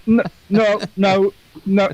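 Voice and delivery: high, rapid protesting no's; mock denial. Teasing friend acts out upset denial. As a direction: play the upset friend denying.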